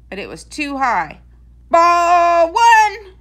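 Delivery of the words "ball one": loud, higher umpire bellow; umpire's call. A narrator's reading. The umpire calls the first strike, a loud high bellow. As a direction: call it out across the field. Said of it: "shouted the umpire".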